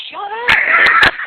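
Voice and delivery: very loud, high-pitched shout; sudden high shriek of a retort; retold shout. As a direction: yell it way up high to silence a brother. Acted panicked retort to yelling brother.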